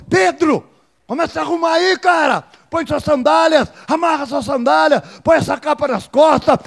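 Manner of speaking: loud, high shouted commands, pushing him up; angel rousing Peter. Acted. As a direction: wake him and drive him to his feet.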